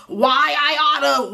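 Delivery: slow, lightly put-on threat; mock fight wind-up. Reviewer imitating a cast member winding up to swing, slow mock threat. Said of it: "winds it up".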